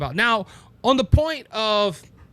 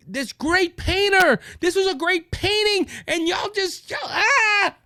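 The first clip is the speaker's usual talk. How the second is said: high-pitched indignant protest, breaking into a shout; imitated outrage; 10 semitones higher than usual. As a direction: act out someone appalled, voice climbing to a cry. Voices atheists outraged about the painting.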